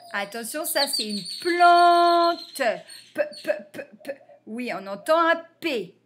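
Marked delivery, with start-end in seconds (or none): 1.4-2.6 "plante" slow, high and loud; sounded out. Shown as a demonstration. Teacher presenting a word to spell, "plante" drawn out. 3.1-4.2 voiceless repeated "p" puffs; isolated consonant. Shown as a demonstration. Teacher helping pupils hear the P, "p" sounded alone.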